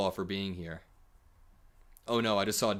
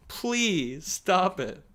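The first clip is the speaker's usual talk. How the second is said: mock begging; 9 semitones higher, 4 dB louder, much slower than usual. Echoes the plea mockingly.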